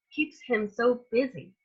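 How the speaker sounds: plain reading voice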